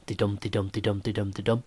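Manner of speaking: "dee" light, "dum" stressed, over and over; iambic beat. Shown as a demonstration. Teacher contrasting iambs with dactyls, weak then strong beat. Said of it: "much like Shakespeare uses iambs".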